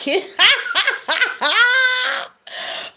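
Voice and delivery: long, high-pitched peals of laughter; real helpless laughter. Real, not acted. Live host cracking up over the attack story, laughing. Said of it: "laughing at me".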